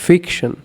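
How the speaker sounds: Fiction with weight on ফিক; stress on first syllable. Shown as a demonstration. Teacher demonstrating stressed syllables, fiction stressed on ফিক. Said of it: "ফিক হচ্ছে".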